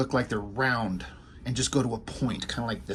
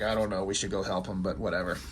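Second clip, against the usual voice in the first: lower, softer lazy giant voice; mild lowered Sasquatch voice; 6 semitones lower, 7 dB quieter than usual. Retold or imitated. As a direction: drop low and shrug it off like a big beast. Sasquatch voiced in comic recap.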